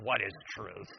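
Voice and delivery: dry, dismissive scoff at the idea; a quiet sneer at truth; sarcastic. A narrator's reading. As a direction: toss it off with contempt. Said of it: "Pilate sarcastically".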